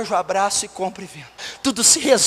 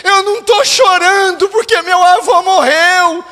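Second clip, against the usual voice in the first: acted crying girl, raised voice; retold tearful protest; 10 semitones higher, 7 dB louder than usual. Grieving girl answering her pastor, acted tearful protest. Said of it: "Essa menina começou a chorar".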